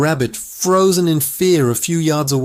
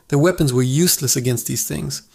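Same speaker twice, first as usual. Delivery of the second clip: plain reading voice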